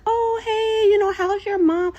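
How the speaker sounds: high, sugary fake-friendly greeting; mock sweet welcome. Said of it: "Playing it off".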